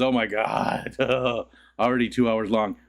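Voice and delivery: usual speaking voice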